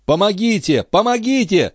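very high-pitched, desperate plea; crying for help. A narrator's reading. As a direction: call for help in a high voice, without real volume. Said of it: "закричал старик". (отчаянный крик о помощи высоким голосом)

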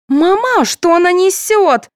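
put-on very high, drawn-out girlish voice; puzzled, childlike high question; baffled daughter. A narrator's reading. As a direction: ask mother in high-pitched bewilderment. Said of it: "не поняла тонкого намёка".

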